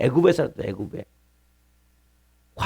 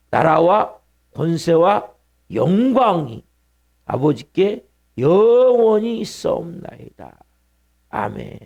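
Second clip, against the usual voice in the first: slow, louder, drawn-out recitation of the closing doxology; unison prayer recitation; 3 dB louder, much slower than usual. Shown as a demonstration. Pastor leads the church in the closing prayer, slow loud unison recitation. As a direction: lead the congregation through each word slowly. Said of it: "자, 시작".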